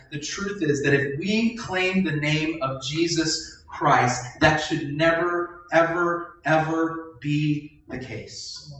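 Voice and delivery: never, ever, ever drawn out slowly; slow emphatic never ever ever; stress on ever. Real, not acted. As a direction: lean hard on each ever.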